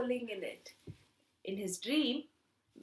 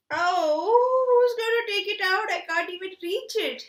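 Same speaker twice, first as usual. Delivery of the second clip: whiny pig; 7 semitones higher, 7 dB louder than usual. Acted. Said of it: "said Popo".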